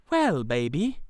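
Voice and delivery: low, soft and tender. Acted. Girl bending down to the boy, soft tender greeting. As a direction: coo it gently to a little child.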